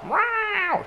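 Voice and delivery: high squeaky meow like a cat. Pretend. Gamer showing off his cat impression: high, loud meow. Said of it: "je fais très bien le chat".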